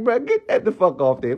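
ordinary talk, nothing marked

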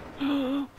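high, startled gasp; sharp gasp. Real, not acted. Gamer seeing the level restart, startled gasp. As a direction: gasp in dismay as it resets.

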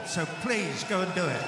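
ordinary delivery

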